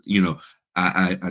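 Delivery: normal talking voice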